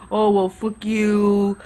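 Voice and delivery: imitated guy backing off with bravado; mock a guy cussing as he retreats; typical guy. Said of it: "does the typical guy thing".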